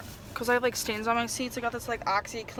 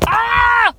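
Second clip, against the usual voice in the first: a short high squeal; real shriek, far higher and louder; excited shriek; 12 semitones higher, 16 dB louder than usual. Real, not acted. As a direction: let out a sudden loud squeal. Frustrated by the watermarks.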